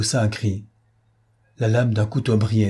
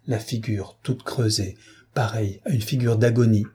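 ordinary delivery, nothing marked

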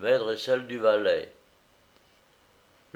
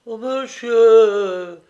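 high, loud, very drawn-out exclamation; disarmed dismay; 11 semitones higher, 10 dB louder, much slower than usual. A narrator's reading. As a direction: wail it slowly, caught out. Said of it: "dit le frontin désarmé".

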